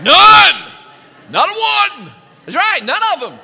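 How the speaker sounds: sudden loud, high outburst; preacher's booming exclamation; emphatic shout. Real, not acted. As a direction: punch out the answer at full volume. Preacher answers his own question.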